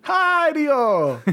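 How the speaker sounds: high, drawn-out greeting in a mock Japanese-Jamaican accent; Japanese-Jamaican accent impression. Podcaster imitating a game character, Japanese-Jamaican accent. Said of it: "weird Japanese Jamaican accent hybrid".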